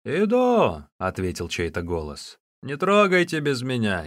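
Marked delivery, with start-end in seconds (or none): 0.0-0.9 high, loud answering shout; short raised holler in reply; calling back. A narrator's reading. Answering a shouted call from afar. 2.6-4.0 loud, raised warning call; high, forceful instruction to the others; calling out orders. A narrator's reading. Unseen man calls back to captors.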